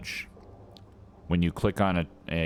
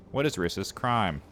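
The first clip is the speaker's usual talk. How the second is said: ordinary talk, nothing marked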